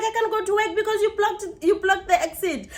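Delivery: high, whining imitation; accusing neighbour. Neighbour blaming driver for blocked exit, high-pitched accusing imitation. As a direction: imitate a complainer laying blame.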